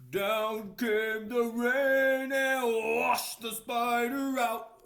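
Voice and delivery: put-on slow, loud read-aloud for children; storybook reading. A narrator's reading. Reading the book page aloud to children, slow and loud. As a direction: read it out big and slow for little listeners.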